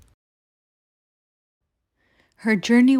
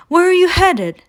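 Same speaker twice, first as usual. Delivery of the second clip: a cheerful, high question from a kind train; friendly train; 10 semitones higher, 5 dB louder than usual. A narrator's reading. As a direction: call out brightly like a friendly engine. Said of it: "asked a friendly train".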